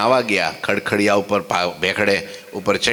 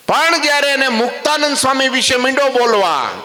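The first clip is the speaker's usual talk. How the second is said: high, raised storytelling peak; dramatic raised sermon line; building emphasis; 14 semitones higher, 4 dB louder than usual. Real, not acted.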